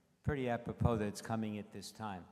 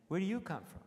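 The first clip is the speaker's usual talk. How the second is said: higher, louder, cheeky wise-guy echo; 9 semitones higher, 6 dB louder, faster than usual. Retold or imitated. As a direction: toss the question back with a smirk. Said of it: "I could be a wise guy".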